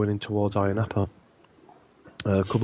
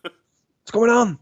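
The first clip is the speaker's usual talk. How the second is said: startled; 11 semitones higher, 5 dB louder, much slower than usual. Retold or imitated. Startled by braking.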